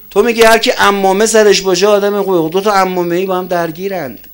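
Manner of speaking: loud, high-pitched challenge from the pulpit; forceful preaching. Real, not acted. Preacher challenging listeners about clerics in conflict, forceful and loud. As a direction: ring it out to the congregation.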